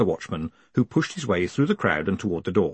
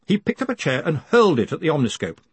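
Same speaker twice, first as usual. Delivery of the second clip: ordinary delivery, nothing marked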